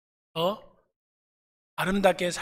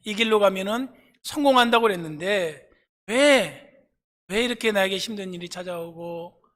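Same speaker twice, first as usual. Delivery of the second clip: louder, driving sermon build; fervent preaching; 2 semitones higher, 6 dB louder than usual. Acted. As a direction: preach it with mounting force. Preacher rising in force.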